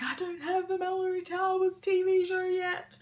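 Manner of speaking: mock-grumpy sulking; grumpy whine. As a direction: whinge it like a grumpy kid. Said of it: "when I was being grumpy".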